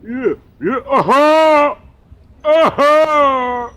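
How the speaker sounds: high, loud, closed-mouth mumbling; shouted mock uh-huhs; mocking his talk. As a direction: ham up a guy who won't open his mouth. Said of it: "when he talk, he talk like this".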